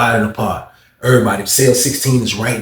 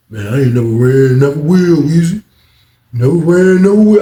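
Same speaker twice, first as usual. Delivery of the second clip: louder defiant, rising; tough boast; 5 semitones higher, 6 dB louder than usual. Retold or imitated. Cellmate boasting he never ran, louder and defiant. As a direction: puff up your chest.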